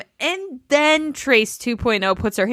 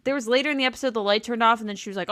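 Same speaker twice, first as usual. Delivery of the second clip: ordinary talk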